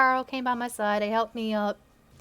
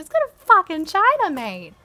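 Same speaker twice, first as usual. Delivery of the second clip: usual speaking voice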